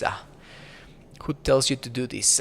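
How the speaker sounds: normal talking voice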